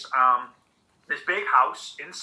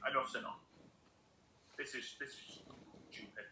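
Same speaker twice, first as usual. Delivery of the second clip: soft, muttered thought to himself; inner thought; 3 semitones lower, 18 dB quieter than usual. Retold or imitated. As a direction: say it under your breath, fed up. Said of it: "he's thinking".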